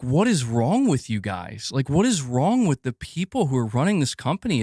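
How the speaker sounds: normal talking voice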